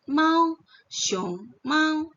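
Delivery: Mao punched high and loud; tonal demo. Shown as a demonstration. Teacher models Xiong Mao for students, high Mao. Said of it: "Kemudian M plus Ao".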